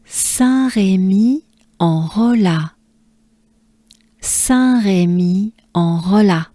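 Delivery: Saint-Rémy-en-Rolla, very slow and clear; slow repeat. Shown as a demonstration. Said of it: "Let's do it together".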